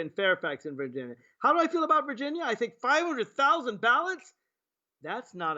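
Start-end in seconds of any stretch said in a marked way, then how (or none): 1.4-4.3 incredulous. Acted. Mocking the question, repeating it.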